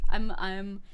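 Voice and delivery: normal talking voice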